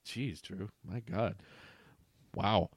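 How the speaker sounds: usual speaking voice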